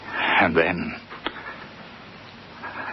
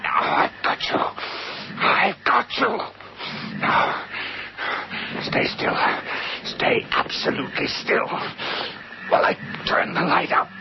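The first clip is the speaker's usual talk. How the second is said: tense grip; 14 semitones higher than usual. Acted. Pinning the creature down.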